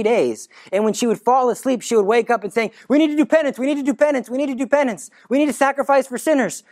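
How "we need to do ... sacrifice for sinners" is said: put-on higher, urgent girl's voice repeating; urgent child pleading. Jacinta waking at night, pleading in a higher urgent child voice. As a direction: plead like a frightened little girl.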